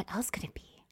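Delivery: soft whispered question; worried whisper. Retold or imitated. Puzzling over what got flagged, whispered. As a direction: wonder it under your breath.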